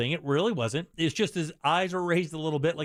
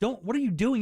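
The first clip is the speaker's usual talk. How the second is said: much higher mock wife, exasperated; scolding wife; 8 semitones higher than usual. Husband retells wife scolding his swearing, higher mock voice. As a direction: play the wife's disbelief, pitched up. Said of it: "She goes".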